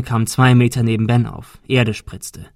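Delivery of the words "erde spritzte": plain reading voice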